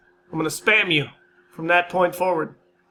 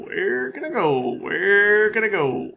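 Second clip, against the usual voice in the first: silly mock panic; 3 semitones higher, slower than usual. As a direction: stretch "Where can I go?" slowly in a goofy voice. Panicking in game.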